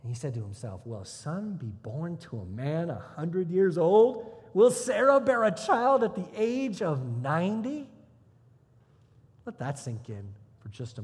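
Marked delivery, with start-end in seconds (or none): 0.8-7.9 drawn-out disbelief, rising louder; incredulous questioning. Acted. Abraham doubts he can father a child, incredulous. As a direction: question it as if it's absurd.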